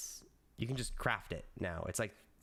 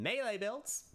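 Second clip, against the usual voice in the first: excited reveal; 10 semitones higher than usual. Shown as a demonstration. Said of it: "I can be funny about this".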